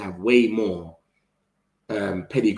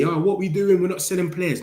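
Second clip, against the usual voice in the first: mock whiny fan; 7 semitones higher than usual. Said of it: "for people that say".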